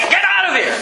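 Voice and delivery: high-pitched order to clear out; acted angry shout. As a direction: yell it as if driving people out. Acting Jesus driving out the sellers.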